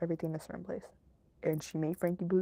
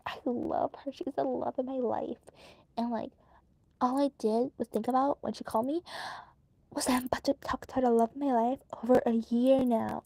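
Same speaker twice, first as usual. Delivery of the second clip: higher, dreamy lovesick mimicry; mocking lovestruck husband; 5 semitones higher than usual. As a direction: swoon over her like a smitten fool. Mocking the husband Kevin gushing.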